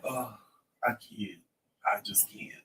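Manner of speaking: breathy near-whisper, barely voiced; too queasy to even say it aloud; weak refusal. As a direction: let it fade to a whisper of defeat. Refusing food while nauseous.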